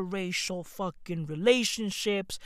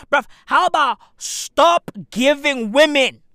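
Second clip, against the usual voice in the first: louder and louder, climbing much higher to a shout; building rant; 5 semitones higher, 9 dB louder than usual. Real, not acted. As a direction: build up to yelling the advice. Ranting at men about money bouquets.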